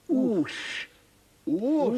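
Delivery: Oosh said with a long oo; Oosh, w sounded as oo; Welsh w as oo. Shown as a demonstration. Teacher showing Fred the Welsh w, saying Oosh. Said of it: "the letter W is said oo".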